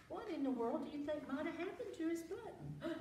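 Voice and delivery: ordinary delivery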